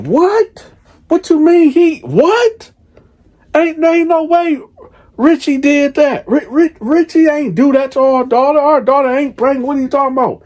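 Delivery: a mother who can't believe what she hears; shocked disbelief. Retold or imitated. Wife on the phone hearing her daughter is pregnant, shocked and high.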